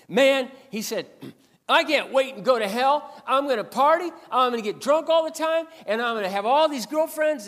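loud, high boast of partying in hell; bragging mechanic. Retold or imitated. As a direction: crow it like a rowdy mechanic. Foul mechanic bragging about hell.

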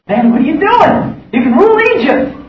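high, mocking scoff at Moses; scoffing girlfriend. Girlfriend scoffing at Moses in the mud pits, high mocking voice. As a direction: sneer at him to go rule Egypt. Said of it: "laughing at him, scoffing at him".

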